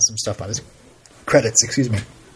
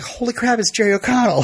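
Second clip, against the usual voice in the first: high mock gasp of excitement; star-struck whisper-shout at seeing a celebrity; starstruck; 10 semitones higher than usual. Retold starstruck reaction.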